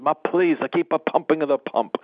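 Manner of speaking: playfully imitating John's Italian English; Italian accent. John urges the tired young man to keep pumping, mild Italian accent. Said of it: "my best impression of John".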